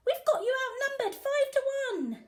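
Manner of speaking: high, loud taunting robber voice; gloating robber. A narrator's reading. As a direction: rub it in his face. Robbers gloat over officer.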